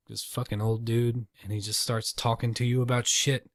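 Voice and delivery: ordinary talk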